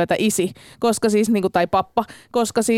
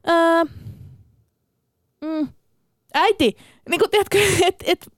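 put-on whiny child; 7 semitones higher than usual. Child dragging out a request.

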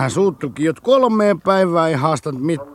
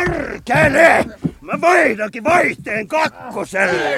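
furious shout; 9 semitones higher, 4 dB louder than usual. Acted. Drunk Koskela brawling in the dugout.

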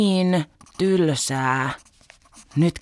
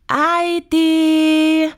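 long drawn-out high call for mum, louder; long shout; 13 semitones higher, 7 dB louder, much slower than usual. Retold or imitated. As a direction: stretch the call out, impatient. (pitkä kovaääninen huuto)

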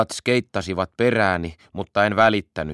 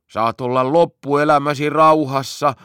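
tearful pleading promise, higher and louder; 6 semitones higher, 5 dB louder than usual. Acted. Locked in the cellar, begging to be let out: tearful. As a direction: beg through tears. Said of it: "Pitkä lupasi itkuisella äänellä".

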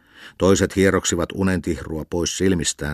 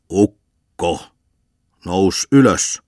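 louder command; shouted wake-up order; 2 semitones higher, 6 dB louder, much slower than usual. Acted. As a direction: roar at him to get up. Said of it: "repi hän sitä kauluksesta".

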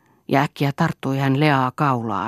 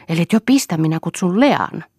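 raised, louder mild threat; threatening; 5 semitones higher, 5 dB louder than usual. A narrator's reading. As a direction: warn with a lifted, insistent voice. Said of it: "uhkasi Toini".

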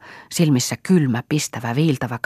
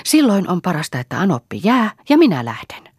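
higher, louder retort; angry; 7 semitones higher, 4 dB louder than usual. Acted. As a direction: fire back at the mother-in-law. Marja loses her temper. (kiukkuinen vastaus)

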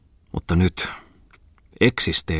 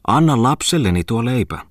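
higher-pitched insistent plea, falling away; high begging call; pleading beggar woman; 6 semitones higher, 4 dB louder than usual. A narrator's reading. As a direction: beg insistently in an acted voice. Beggar woman pleads for her child.

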